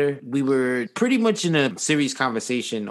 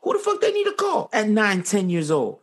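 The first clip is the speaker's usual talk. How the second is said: much higher, pushing hard with disbelief; heated outburst; 9 semitones higher than usual. Real, not acted. Heated argument about kids' phones.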